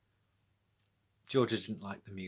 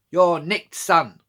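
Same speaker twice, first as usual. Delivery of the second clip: loud, relished telly-police catchphrase; tough cop line; 6 semitones higher, 11 dB louder, slower than usual. A narrator's reading. Village policewoman arresting the thief, loud telly-cop line. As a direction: savour it like a TV copper. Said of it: "heard the police say that on the telly".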